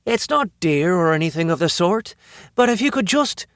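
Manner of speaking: high, laid-on Irish lilt; thick Irish accent. A narrator's reading. Woman stalling a robber for her lighter, thick Irish accent. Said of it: "She laid her Irish accent on thick".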